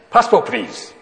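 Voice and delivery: officer's call. Retold or imitated. Officer asks each traveller.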